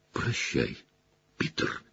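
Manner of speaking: low and very soft; cold quiet farewell. Acted. Sirius bids Pettigrew goodbye, very soft and low. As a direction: say goodbye before the kill, barely voiced.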